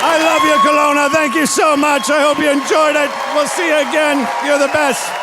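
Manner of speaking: shouted thanks to the whole room; loud closing thanks over the applause; loud sign-off. Real, not acted. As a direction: send the crowd off with a big shout. Comic shouting goodbye to crowd.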